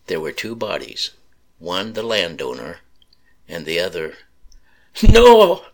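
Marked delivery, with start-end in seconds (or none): 5.0-5.7 high, loud anguished wail; grief-stricken cries of no; crying out. A narrator's reading. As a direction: cry out in grief at the body. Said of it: "she cried".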